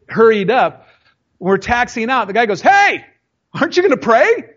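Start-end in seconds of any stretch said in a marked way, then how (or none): 2.6-4.5 a light high-pitched take on the fellow pilot; mock the friend's eager nudge to pray; imitating friend. Pilot friend asks pastor to pray.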